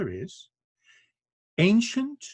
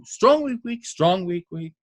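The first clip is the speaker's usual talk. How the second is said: "strong" high and stressed, each "weak" lighter; 3 semitones higher than usual. Shown as a demonstration. Spelling out the dactyl's beats, strong-weak-weak stress. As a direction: punch "strong", let both "weak"s fall away. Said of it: "one strong syllable followed by two weak".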